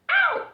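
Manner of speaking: high loud ow; sudden pained squeal; sharp yelp of pain. Acted. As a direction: yelp as you trip. Bean trips in story.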